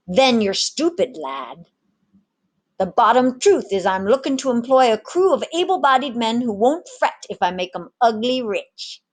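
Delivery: a one-armed rogue selling gold to sailors; swaggering pirate mate. Acted. Ginger recruits the tavern crew.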